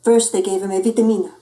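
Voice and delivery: ordinary delivery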